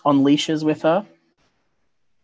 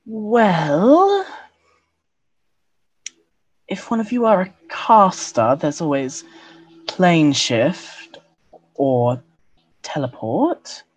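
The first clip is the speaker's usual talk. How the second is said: higher, sultry elf woman; femme fatale; 8 semitones higher than usual. Acted. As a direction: purr it like a seductress. GM voices elven woman NPC.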